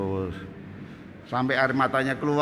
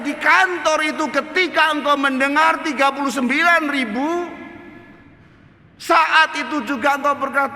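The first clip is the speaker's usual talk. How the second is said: high, loud, forceful reproach; God's rebuke; 13 semitones higher, 8 dB louder than usual. Retold or imitated. God questioning the preacher's faith, loud stern rebuke. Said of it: "Tuhan berbicara sama saya".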